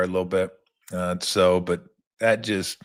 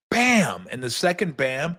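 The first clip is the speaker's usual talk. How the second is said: ordinary delivery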